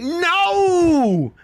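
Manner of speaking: high wailing mock despair; mocking Hassan. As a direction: whine like a sore loser. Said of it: "He's like".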